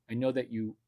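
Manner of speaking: ordinary talk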